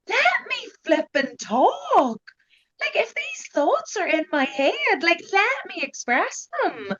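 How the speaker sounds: high-pitched, drawn-out exasperation; exasperated plea. Retold or imitated. Answering people who keep reassuring her, exasperated high-pitched plea. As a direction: plead to be heard, voice pitched right up.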